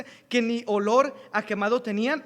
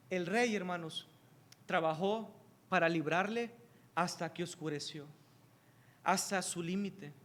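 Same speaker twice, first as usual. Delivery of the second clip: low, soft and solemn, slowing down; soft, grave sermon voice; hushed preaching; 4 semitones lower, 7 dB quieter than usual. Acted. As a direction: draw the congregation in with a hush.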